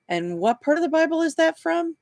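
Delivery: high-pitched, doubtful probing; sceptical questioning. A narrator's reading. Detective humours the supposed believer.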